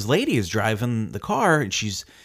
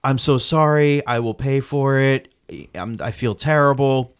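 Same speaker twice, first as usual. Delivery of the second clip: high, loud, pleading apology voice; imitating a frantic sorry lady; apologetic driver; 5 semitones higher, 5 dB louder than usual. Driver apologizing to the homeowner, frantic high pleading. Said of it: "And she's like".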